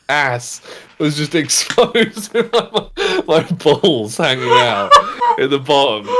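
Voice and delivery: amused, laugh-filled storytelling; laughing while talking. Real, not acted. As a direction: tell it through helpless giggles. Laughing through own embarrassing story.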